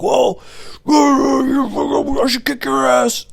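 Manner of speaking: high, garbled mock rant; drunken threat. Drunk customer threatening the driver, imitated garbled high yelling. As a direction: slowly yowl a threat like a drunk. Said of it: "He's drunk".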